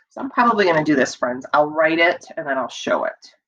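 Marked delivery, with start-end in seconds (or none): none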